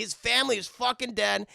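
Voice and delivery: ordinary talk, nothing marked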